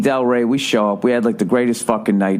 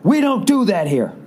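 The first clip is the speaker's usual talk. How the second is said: high-pitched, halting reprimand; scolding dad-like agent; 12 semitones higher than usual. Retold or imitated. Counter agent to an arguing traveler, hand out, scolding. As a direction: imitate an agent shutting a customer down. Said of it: "Like when your dad used to say".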